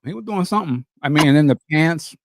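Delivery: ordinary talk, nothing marked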